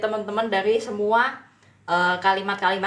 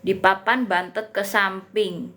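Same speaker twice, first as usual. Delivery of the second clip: usual speaking voice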